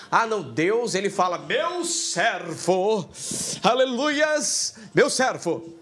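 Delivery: a hint of a dramatic pious voice, slowed; hammed-up churchy believer quoting God; mock pious believer. To his students, mocking a believer who hears "meu servo", lightly pious.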